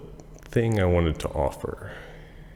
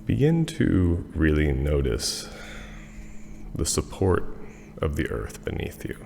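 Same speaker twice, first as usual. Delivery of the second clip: guided meditation. Acted. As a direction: ease the listeners down to rest. Leads a Yoga Nidra meditation.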